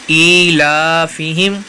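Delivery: ایلافہم said very slowly, syllable by syllable; careful drawn-out ایلافہم; slow recitation demo. Shown as a demonstration. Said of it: "فا کو بغیر کھینچے پڑھیں گے".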